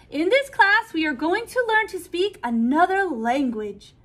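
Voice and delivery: put-on loud, high, accented teacher announcing to class; teacher's announcement. A narrator's reading. Miss Chu opens her art class, loud and bright. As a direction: call the class to attention with a lilting Chinese accent. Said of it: "Miss Chu's accent".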